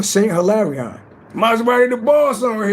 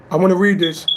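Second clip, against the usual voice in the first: ordinary talk, nothing marked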